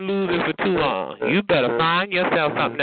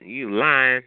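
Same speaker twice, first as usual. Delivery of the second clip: usual speaking voice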